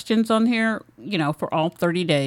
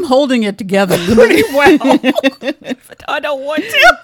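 holding back laughter; trying hard not to crack up; stifled giggles; 6 semitones higher, 6 dB louder than usual. Real, not acted. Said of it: "I'm trying not to laugh".

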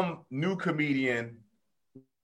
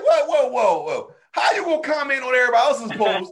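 high, loud mock alarm; mock shocked whoa; 9 semitones higher, 3 dB louder than usual. Mocking a touchy friend, high exaggerated whoa. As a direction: throw up your hands at a hypocrite.

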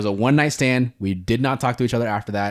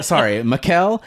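normal talking voice

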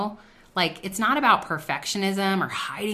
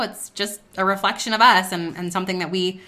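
ordinary talk, nothing marked

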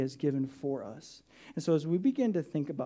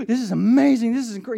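gasping wonder; 8 semitones higher, 10 dB louder than usual. Retold or imitated. Thankful person gasps in awe.

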